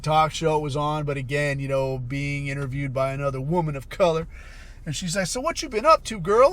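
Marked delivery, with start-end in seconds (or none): 5.3-6.5 put-on high, loud girlfriend-style greeting; imitated chatty interviewer. Interviewer greeting her guest, mock chummy high voice. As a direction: mimic a host's sassy high hello.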